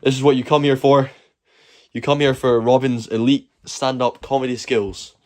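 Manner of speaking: ordinary talk, nothing marked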